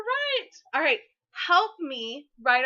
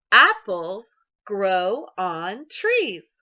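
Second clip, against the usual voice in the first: slow choral reading; 5 semitones lower, 4 dB louder, much slower than usual. Shown as a demonstration. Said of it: "Can you say that with me?".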